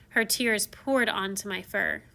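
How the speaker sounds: ordinary delivery, nothing marked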